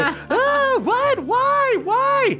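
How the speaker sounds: high-pitched yelping scoutmaster; Scoutmaster Denny yelling. Acted. Scoutmaster tackled by an intruder, high-pitched yelling. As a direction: cry out in a very high, startled voice. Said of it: "hears Scoutmaster Denny yelling".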